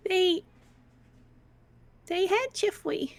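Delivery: put-on a guilty child defending herself; wide-eyed little girl protesting; Missy the little girl. Player voices Missy.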